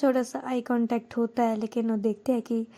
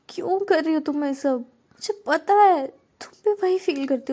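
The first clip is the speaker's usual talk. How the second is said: emotional pleading; 7 semitones higher, 3 dB louder than usual. Acted. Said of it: "बहुत प्यार से देखते हुए बोलती".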